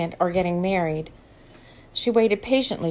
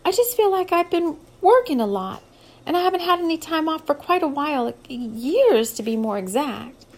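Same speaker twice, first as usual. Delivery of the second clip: gaining momentum; 7 semitones higher, 4 dB louder than usual. A narrator's reading. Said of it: "starting to get her momentum up".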